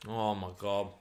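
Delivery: quiet, mouth full of hot pizza; wolfing down food. Real, not acted. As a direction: talk through a hot mouthful. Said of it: "Sorry, I'm starving".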